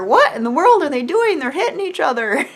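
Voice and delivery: high, exasperated mock outcry; mock bewilderment. Mom baffled by sons hitting, high mock outcry. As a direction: exclaim it in hammed-up disbelief.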